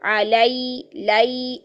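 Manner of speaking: "अलई, लई" with a drawn glide from a to i; careful diphthong in "अलई, लई". Shown as a demonstration. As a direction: turn the a of "लई" smoothly into i. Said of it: "जबर को अदा करते हुए या की तरफ मुड़ेंगे".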